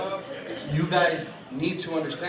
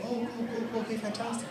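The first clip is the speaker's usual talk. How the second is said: put-on soft, higher-pitched little old lady; 6 semitones higher, 11 dB quieter, faster than usual. Old fitting-room lady answering a customer, softly agreeable. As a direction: voice a mild old attendant, quietly. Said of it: "I remember the little old lady".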